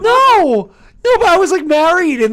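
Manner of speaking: loud, high protest; shocked shouting. Real, not acted. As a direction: yell it out in disbelief. Shocked by affair reveal.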